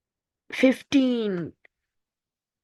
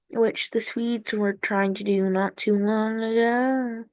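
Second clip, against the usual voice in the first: normal talking voice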